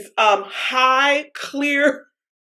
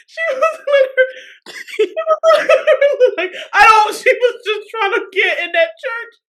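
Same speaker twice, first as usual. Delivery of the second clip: very high, squealing laugh-talk; real tearful laughter, voice pitched way up; crying with laughter; 7 semitones higher than usual. Real, not acted. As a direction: struggle to speak through helpless laughter. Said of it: "I'm crying".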